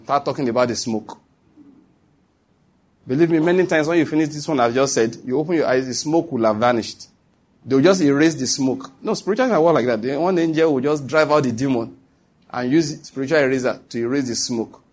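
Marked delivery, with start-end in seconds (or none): none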